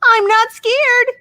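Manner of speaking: high, slow squeaky duck voice; tiny duckling insisting it's brave; squeaky little duck. Acted. Second duck, same line.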